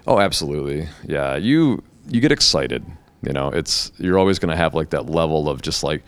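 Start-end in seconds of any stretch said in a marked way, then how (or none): none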